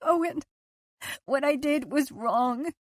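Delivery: pleading remorse. A narrator's reading. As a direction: plead for him to see. Liz pleading.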